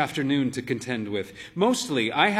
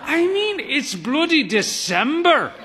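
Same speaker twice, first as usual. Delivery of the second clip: put-on high, louder outraged old lady; indignant old mother; 13 semitones higher, 6 dB louder than usual. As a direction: huff in mock outrage.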